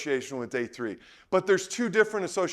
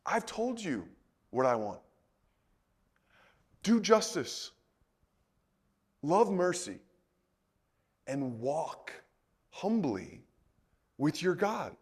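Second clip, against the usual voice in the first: soft answer from God; 5 semitones lower, 7 dB quieter than usual. Retold or imitated. God's answer to Micah.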